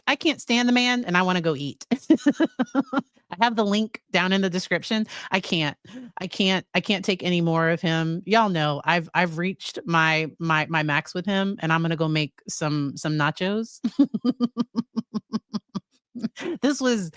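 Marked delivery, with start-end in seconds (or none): 1.9-3.0 real high-pitched giggle; exasperated laugh. Real, not acted. Host who can't stand him, exasperated laughing. Fed up, wants to eat. 13.9-15.8 real, higher-pitched rolling laugh; long giggle. Real, not acted. Host off to make nachos, long giggling laugh.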